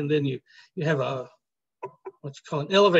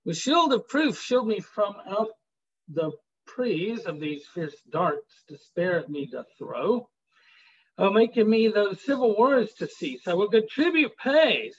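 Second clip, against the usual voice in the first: raised, declaiming poetry reading; dramatic sonnet reading; poem recitation; 5 semitones higher than usual. Acted. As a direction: read the verse out like a performance. Reads sonnet aloud to class.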